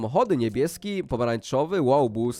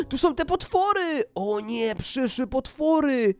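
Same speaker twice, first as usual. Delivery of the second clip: gasp, then high-pitched playful dread; alarmed discovery; 7 semitones higher, slower than usual. Pretend. Player spying monsters from the roof, hammed-up alarm.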